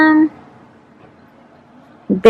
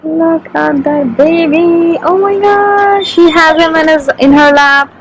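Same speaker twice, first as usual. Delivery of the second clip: slow, much higher coo over the puppy; 6 semitones higher, 3 dB louder than usual. Real, not acted. First seeing the new puppy in her lap, a slow high coo. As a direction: melt over a tiny puppy.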